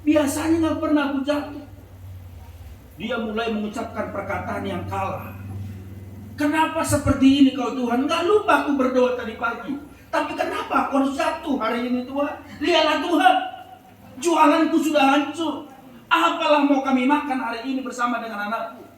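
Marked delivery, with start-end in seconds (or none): 0.0-1.6 higher, louder cry of hurt to God; pained complaint to God. Retold or imitated. Mother fallen in the mud complains to God, pained. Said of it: "dia sambil ngomong kepada Tuhan". 6.3-18.9 angry lament. Retold or imitated. Mother scolds God over her crushed tofu, angrily. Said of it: "sambil dia marah-marah".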